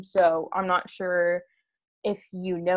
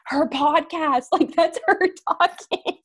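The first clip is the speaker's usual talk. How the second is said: higher and laughing, imitating the friend; 9 semitones higher, 5 dB louder than usual. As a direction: blurt it out through laughter. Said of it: "And my friend goes".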